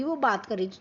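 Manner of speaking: ordinary talk, nothing marked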